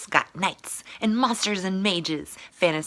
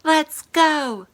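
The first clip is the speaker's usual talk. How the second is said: yelled battle cry; 9 semitones higher, 8 dB louder than usual. Acted. Knights yell battle cry.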